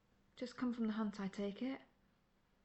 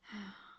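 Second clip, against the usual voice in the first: long soft sigh of annoyance; quiet exasperated exhale; weary sigh; 7 dB quieter than usual. Acted. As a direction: let out a tired, resigned sigh. Hunter realising rival took bounty.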